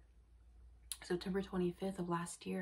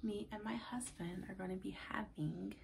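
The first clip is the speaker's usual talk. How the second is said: whisper; 7 dB quieter than usual. Real, not acted. Whispered secret announcement.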